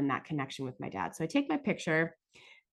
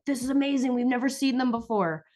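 excited fans; 8 semitones higher, 3 dB louder than usual. Retold or imitated. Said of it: "they're like".

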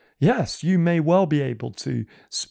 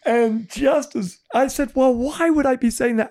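incredulous; 11 semitones higher than usual. Retold or imitated. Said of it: "I'm like".

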